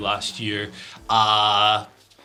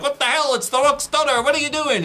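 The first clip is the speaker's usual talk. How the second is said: outraged fan; 12 semitones higher, 3 dB louder than usual. Retold or imitated. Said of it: "some people were like".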